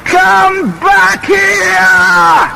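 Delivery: put-on very high, drawn-out yell after fleeing kids; furious shout. A narrator's reading. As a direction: bellow it down the hall, very slow. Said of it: "he shouts".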